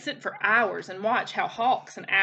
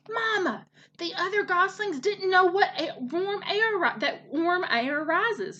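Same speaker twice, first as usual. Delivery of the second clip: put-on high, childlike excited report; eager young gosling; 8 semitones higher than usual. A narrator's reading. Gosling tells his mother about flying, eagerly. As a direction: burst in eagerly, pitched high.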